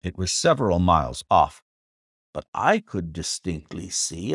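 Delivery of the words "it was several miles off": usual speaking voice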